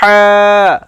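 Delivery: teacher demonstrates ہا for the class; breathy throat ha. Shown as a demonstration. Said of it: "ایسے sound میں دکھاتا ہوں آپ کو".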